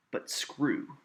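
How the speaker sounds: "But screw" stressed on SCREW; light "But", strong falling "screw"; iamb stress. Shown as a demonstration. Teacher demonstrates one metrical foot, punching SCREW after a light "But". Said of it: "an unaccented syllable followed by an accented syllable".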